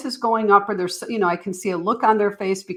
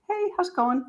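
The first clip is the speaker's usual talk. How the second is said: gentle check-in; 10 semitones higher, 5 dB quieter than usual. Retold or imitated. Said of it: "I might circle back and just say".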